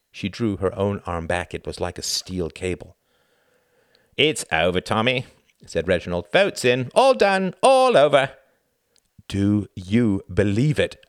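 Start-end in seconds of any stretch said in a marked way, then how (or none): 4.2-5.3 firm, louder brush-off; dismissive. A narrator's reading. Reginald telling Tom the debate is over, dismissive. 6.3-8.3 high, loud, emphatic phrases; loud dismissal. A narrator's reading. Reginald ending the argument with Tom, loudly dismissive. 9.3-11.0 very slow, loud, deliberate question; dogged insistence. A narrator's reading. Tom refusing to drop it with Reginald, doggedly insistent.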